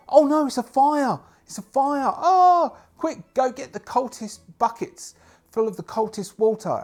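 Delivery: high, loud frantic crowd cries; panicking crowd. Acted. Townsfolk panic at the fire, crying out high. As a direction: squeal in alarm and rush for water. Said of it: "They're going".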